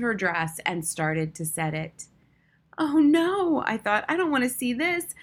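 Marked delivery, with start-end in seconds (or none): 2.8-3.6 high, louder dismayed cry; horrified oh-no; alarmed thought. A narrator's reading. Narrator dreads seeing the mousetrap. 4.1-5.1 high-pitched dread; anxious, pitched-up plea; dismayed thought. A narrator's reading. Narrator dreads seeing the mousetrap.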